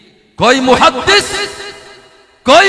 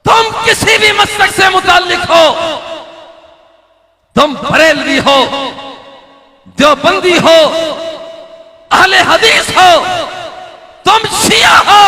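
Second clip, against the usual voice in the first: climbs to a high, loud cry; building sermon shout; 11 semitones higher, 5 dB louder than usual. Acted. Preacher calling out to the people of Karachi, building shout. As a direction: call out to every sect, louder each time. Preacher calls out to all sects.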